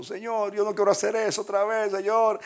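a hint of weeping believer praying to God; tearful plea. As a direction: beg God with a catch of tears. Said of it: "delante de Dios llorando".

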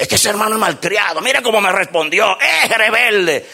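mock indignant griping; a hint of a petty complainer; complaining church member. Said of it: "Y algunos dicen".

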